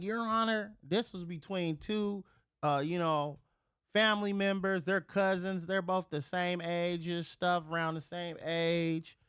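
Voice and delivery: pleading mom. Retold or imitated. Mom explaining the cousins' fight to the judge, pleading. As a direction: lightly imitate a mom reasoning with a judge.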